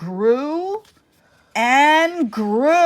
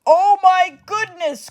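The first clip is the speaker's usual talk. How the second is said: higher, louder cry of alarm; the giantess panics; 9 semitones higher, 6 dB louder than usual. Acted. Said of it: "cried the giantess".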